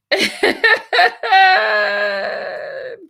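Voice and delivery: long, real cackle trailing out; big laugh. Real, not acted. Streamer tickled by a donor's message, big laugh. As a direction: burst into a drawn-out belly laugh.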